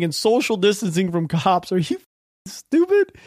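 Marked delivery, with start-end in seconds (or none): 1.7-3.1 you can't be serious, spoken to the writer; incredulous. Real, not acted. Ranting at the article's author, incredulous disbelief.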